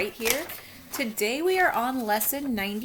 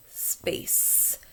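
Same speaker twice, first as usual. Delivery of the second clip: whispered word; 4 dB quieter than usual. Shown as a demonstration. Teacher repeating the word for students in a whisper. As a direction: Space whispered with no voice. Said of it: "final sound in space".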